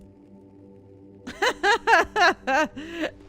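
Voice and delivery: loud, high giggling burst; real laugh. Real, not acted. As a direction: burst out laughing in a run of ha-has. Cracks up at the screen.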